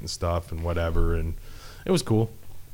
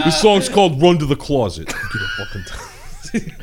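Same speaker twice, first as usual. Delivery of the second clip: Brooklyn accent impression; 10 semitones higher, 8 dB louder than usual. Continues the mock song intro bit.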